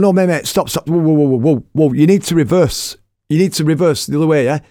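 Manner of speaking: acted-out alarmed instructions; urgent calling out. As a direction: call out to stop a car coming at you. Halting car driving at him.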